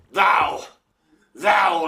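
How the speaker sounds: father's outrage bursting out at the suitor; angry accusation. Acted. Father denouncing Lysander before the duke, angry outburst.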